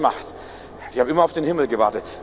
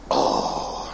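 long drawn-out groan into the phone; imitated moan of despair; desperate groan; 3 dB quieter than usual. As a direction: groan it out slowly. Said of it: "warum stöhnst du im Telefon".

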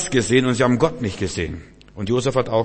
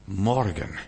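soft, lower echo of the proclamation; hushed repeat; 4 semitones lower, 6 dB quieter than usual. Acted. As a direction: let the word drop quietly. Same word repeated softly after shout. (leise, tiefer nachgesetzt)

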